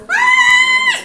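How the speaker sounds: loud, high "ay" shriek; high pained yelp. Real, not acted. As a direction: yelp sharply at a bite. Bitten by the dog. (grito agudo de dolor)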